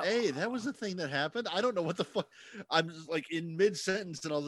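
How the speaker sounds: high, amazed outburst; baffled and wound up; excited. Real, not acted. Guest back after computer crash.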